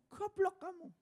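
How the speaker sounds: high squawky parrot repeating the insult. Retold or imitated. Parrot copying the master's insult, high squawk.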